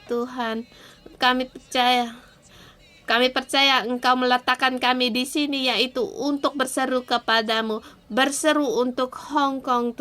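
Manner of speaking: earnest pleading prayer to God; fervent prayer. Acted. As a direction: pray aloud for the city, pleading. Preacher leads fervent prayer. (doa yang sungguh-sungguh memohon)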